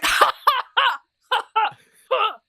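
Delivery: high, loud put-on "ha ha" laugh; mock triumphant laugh. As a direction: laugh it out in exaggerated victory. Acting out her triumphant reaction.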